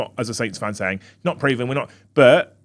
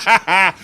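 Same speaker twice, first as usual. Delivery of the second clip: loud, high burst of real laughter; 8 semitones higher, 6 dB louder than usual. Real, not acted. Co-hosts laugh at joke.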